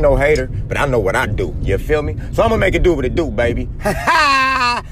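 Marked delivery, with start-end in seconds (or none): 3.8-4.8 shouted laugh. Acted. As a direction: bark out a big signature laugh. Signature shout after the bit.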